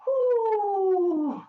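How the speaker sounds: high happy whoo; joyful whoop. Pretend. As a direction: whoop as you land. Landing after flying.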